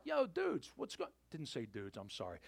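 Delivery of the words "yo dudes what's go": light imitation of calling out; mock yell. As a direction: hail some dudes from the shore, jokily. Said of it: "Jesus is on the shore and yells out".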